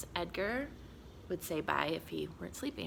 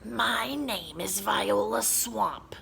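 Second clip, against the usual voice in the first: slow, scratchy whisper; 3 semitones higher, 4 dB louder, slower than usual. Acted. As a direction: drag it out like a menacing witch. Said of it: "said a lady in a scratchy voice".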